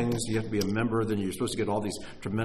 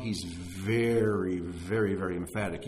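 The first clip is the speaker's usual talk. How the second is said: emphatic drawl; 5 semitones lower than usual. Shown as a demonstration. Drawing out the enemy's emphatic manner.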